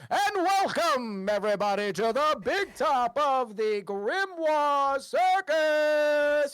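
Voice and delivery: big, loud, high announcer with long-drawn words; grand, slow ringmaster welcome; circus ringleader. Acted. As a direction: belt it out to the whole big top. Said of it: "they hear the ringleader go".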